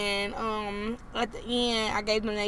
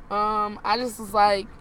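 ordinary delivery

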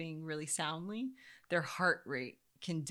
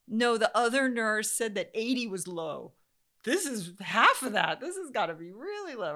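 louder, higher agitated insisting; 6 semitones higher, 10 dB louder than usual. Retold or imitated. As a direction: push back frantically. Panicked arguing with nurse.

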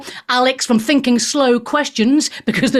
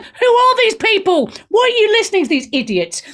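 incredulous outburst; 11 semitones higher than usual. Retold or imitated. Mocking the listeners of Goop.

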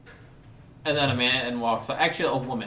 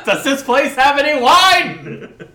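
laughing; 13 semitones higher, 9 dB louder, slower than usual. Real, not acted. Laughing asks about wine.

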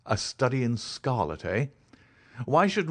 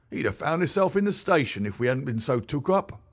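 higher, louder common London constable; a plain-spoken beat policeman telling his tale, pitched up; constable Rance; 6 semitones higher, 3 dB louder than usual. Acted. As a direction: policeman recounting what he found, higher and louder. Rance continues.

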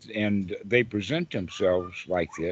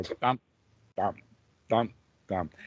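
slow heartbeat thumps; 4 semitones lower, 4 dB quieter than usual. Shown as a demonstration. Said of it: "if it's really slow".

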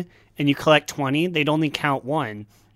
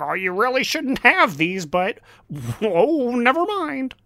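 mock game voice, higher; 7 semitones higher than usual. Game message retold again, higher.

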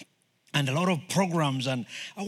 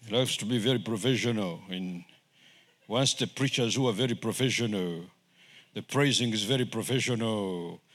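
cold professional; 9 semitones lower, 3 dB quieter than usual. Retold or imitated. Describing cold professional church, mimicking its tone.

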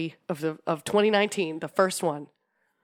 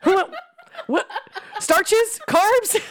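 excited; 13 semitones higher, 4 dB louder, much slower than usual. Real, not acted. Silly food riff.

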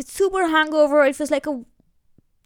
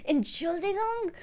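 mock polite challenge; 5 semitones higher, 7 dB quieter than usual. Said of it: "I just turned around and I was like".

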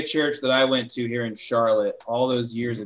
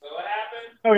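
cave echo; 7 semitones higher than usual. Real, not acted. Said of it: "now [name] sounds like he's in a cave".

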